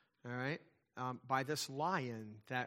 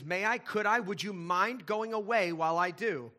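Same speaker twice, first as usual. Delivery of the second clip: higher and louder, anxious begging; pleading girl; 5 semitones higher, 8 dB louder than usual. Acted. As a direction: beg the lion timidly, voice pitched up. Frightened Jill begs the lion.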